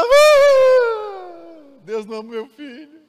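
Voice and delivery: high, loud anguished wail, then high sobbing words; a grieving mother's crying cry. Retold or imitated. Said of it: "Começou a chorar mais ainda".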